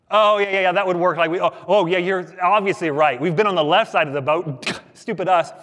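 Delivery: loud, mock-enthusiastic agreement; sarcastic agreement. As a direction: agree as if it were ridiculous advice. Disciples acted mocking Jesus's advice.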